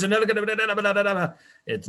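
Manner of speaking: loud, high, very slow emphatic delivery; a forceful loud voice that sounds angry; speaking loud. Shown as a demonstration. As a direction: talk big and loud like you're pissed off. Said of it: "if you speak like this".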